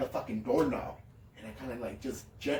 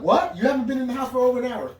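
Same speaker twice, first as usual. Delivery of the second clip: high, loud, fast disbelieving shout; retelling a panicked phone shout; shocked yell; 10 semitones higher, 8 dB louder than usual. Retold or imitated. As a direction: blurt it out in total disbelief. Retold shock at Betsy's answer.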